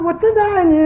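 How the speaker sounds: high, sustained call across the mountains. Retold or imitated. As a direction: act out a child calling out earnestly to an echo. Child calling out into the mountains. (模仿孩子向山里高声呼喊)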